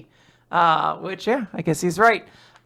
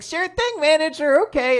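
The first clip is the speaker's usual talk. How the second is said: chirpy waitress; 11 semitones higher than usual. Acted. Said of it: "she's like".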